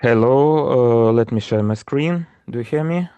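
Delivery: ordinary delivery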